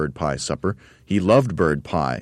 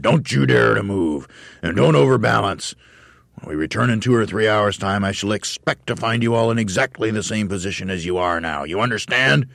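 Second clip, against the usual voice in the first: higher, louder drill bark; barked command; 5 semitones higher, 4 dB louder than usual. Acted. Mister Twit barking orders at the monkeys. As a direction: bark like a fearsome trainer. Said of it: "Mister Twit ordered".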